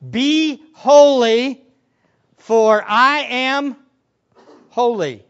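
loud, very slow proclamation; booming command. Acted. Preacher quoting God's command, slow booming proclamation. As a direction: stretch each word out with weight.

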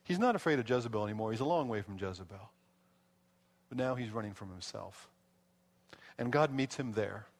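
ordinary talk, nothing marked

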